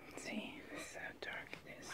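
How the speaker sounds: fast hushed whisper. Real, not acted. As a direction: whisper quickly to yourself. Whispered aside.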